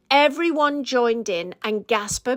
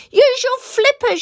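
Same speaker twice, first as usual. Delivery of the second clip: much higher shout of encouragement; 13 semitones higher, 3 dB louder than usual. A narrator's reading. Friend diving in over the captain's head, shouting advice. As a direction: call it out over the splash. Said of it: "shouted Rossa".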